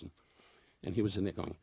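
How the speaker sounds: usual speaking voice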